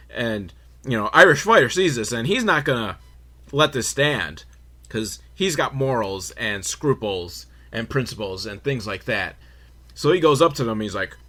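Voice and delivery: ordinary talk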